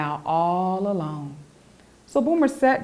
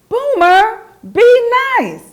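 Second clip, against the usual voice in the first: high, loud scolding cry; scolding shout; 9 dB louder, slower than usual. Acted. The girls scold Boomer for pushing Abby, shouting high. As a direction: shout it sharply at the bully.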